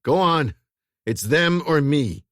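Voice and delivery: firm insistence. A narrator's reading. Dermot insisting.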